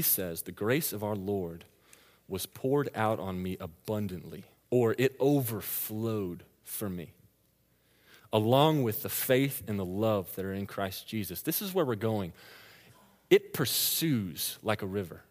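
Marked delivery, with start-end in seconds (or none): 0.4-11.4 soft, slow reading of the verse; scripture reading. Acted. Preacher reading Paul's words to the congregation, soft and slow. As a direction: read it gently and slowly.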